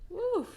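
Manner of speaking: high loud exhale to steady herself; whoo. Real, not acted. Catching herself mid-story, high loud whoo. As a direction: blow it out to calm down. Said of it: "I need to calm down".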